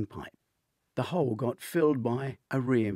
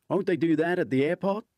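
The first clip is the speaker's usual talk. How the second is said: casual question; 8 semitones higher, 6 dB louder than usual. A narrator's reading. Hunter asks Dwight.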